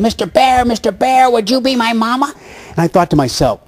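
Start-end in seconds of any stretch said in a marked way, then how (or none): 0.0-2.3 higher pleading cartoon duckling; little duck voice. Duckling begs Yogi to be his mother, high cartoon duck. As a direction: plead like a lost baby duck. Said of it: "he keeps saying".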